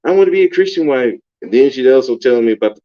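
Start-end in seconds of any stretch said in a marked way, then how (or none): 0.1-1.1 higher, quicker mock of her; lightly voiced pious girlfriend; a hint of mock innocence; mimicking the chick. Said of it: "this is the chick telling me".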